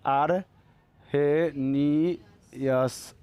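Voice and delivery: very slow, low, syllable by syllable; drawn-out syllables. Real, not acted. Teacher writing the name for the class, drawn-out syllables. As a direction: stretch each syllable as if writing it down.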